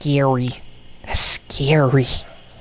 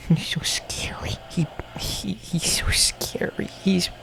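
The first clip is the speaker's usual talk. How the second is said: hushed spooky whisper; breathless scared whisper; 3 semitones higher, 8 dB quieter than usual. Pretend. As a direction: whisper it like he might hear you. Whispering about scary cat.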